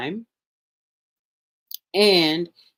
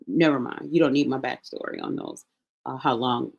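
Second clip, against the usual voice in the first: usual speaking voice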